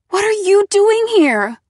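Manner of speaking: high, loud cry of shock; shocked outburst. Acted. Girl finds Nate in the kitchen, shocked outburst. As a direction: blurt it out, high and startled. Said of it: "I choked out".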